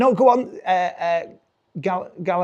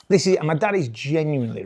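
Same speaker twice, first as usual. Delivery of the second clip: ordinary talk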